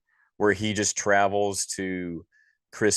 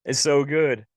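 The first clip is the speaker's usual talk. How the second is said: "It's so good" much higher and louder; emotional; 7 semitones higher, 5 dB louder than usual. Real, not acted. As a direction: let "It's so good" burst out with feeling.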